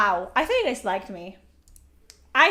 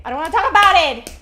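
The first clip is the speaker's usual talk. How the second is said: loud, fast, higher outburst; shouting; 4 semitones higher, 10 dB louder, faster than usual. Pretend. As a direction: blurt it out in a shout. Shouted repeat.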